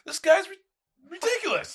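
high, loud exasperation; retold outraged complaint. Customer griping to friends about the bartender, outraged. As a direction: play up the outrage, voice jumping high.

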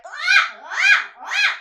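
put-on very high, loud baby wails; imitated baby cry. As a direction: mimic a baby crying in piercing bursts. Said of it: "when they're crying".

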